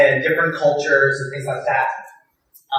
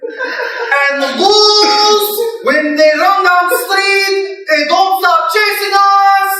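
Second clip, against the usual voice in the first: put-on Egyptian accent; 5 dB louder, much slower than usual. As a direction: wail "the ghouls" in a lilting chant. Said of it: "They're like".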